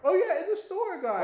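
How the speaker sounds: high loud laughing reply. Real, not acted. Answering his friend's question, high loud laughing. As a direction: laugh it out in disbelief.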